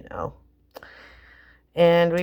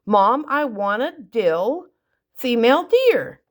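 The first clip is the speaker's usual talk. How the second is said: put-on insistent kid; 6 semitones higher, 3 dB louder than usual. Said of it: "And he goes".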